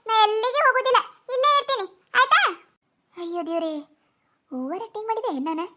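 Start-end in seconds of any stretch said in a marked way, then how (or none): 3.2-5.7 lower, softer worried aside; daughter-in-law's low, soft aside to herself; quiet aside. Acted. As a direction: mutter to yourself, fearing you overdid it. Private aside after overacting.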